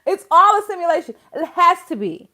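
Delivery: exasperated insistence. Real, not acted. Insisting it cannot be real.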